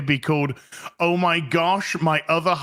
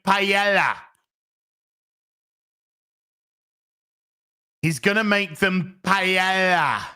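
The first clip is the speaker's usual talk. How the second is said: mock Spanish accent; 3 semitones higher than usual. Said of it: "because he has a Spanish accent".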